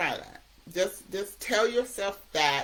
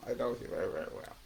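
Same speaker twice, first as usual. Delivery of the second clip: "very well" repeated, fading much softer; quieter repeat; 6 semitones lower, 8 dB quieter, much faster than usual. Shown as a demonstration. Speaker repeating the point to the audience, softer. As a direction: let "very well" sink to a hush.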